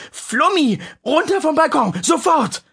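put-on high, loud command to a child; urgent shout. Mother shouts at her daughter on the balcony, loud and urgent.

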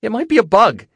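very high, loud squeal. A narrator's reading. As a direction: squeamish shriek about a creepy thing. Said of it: "she squealed".

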